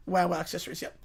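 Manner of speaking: usual speaking voice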